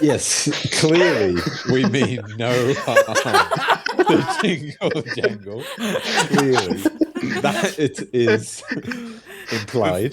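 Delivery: very slow, drawn-out words, amused; ponderous and deliberate, laughter creeping in; unhurried. Acted. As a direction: stretch each word out with pauses, nearly laughing. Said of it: "you are not a hasty creature either".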